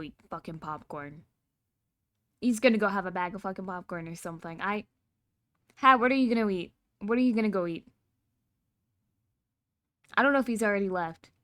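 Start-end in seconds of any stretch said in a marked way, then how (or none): 5.8-7.9 loud call across. Real, not acted. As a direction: call out loudly. Calling out to Hat.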